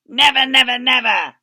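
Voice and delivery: loud, high repeated cry; crying Boggis. Acted. Boggis crying out his oath to the others. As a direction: hammer each never harder. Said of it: "cried Boggis".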